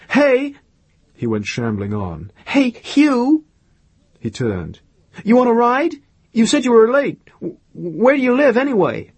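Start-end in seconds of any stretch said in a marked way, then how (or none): 0.1-0.5 high, loud hail; raised, high-pitched shout to get attention; calling out. A narrator's reading. Calling after him as he leaves. 2.5-3.4 very high, loud hail; raised, high-pitched shout; calling out. A narrator's reading. Calling him back. 5.2-9.1 high, loud, urgent questions; raised voice, pitched way up; calling out. A narrator's reading. Calling to him across a distance.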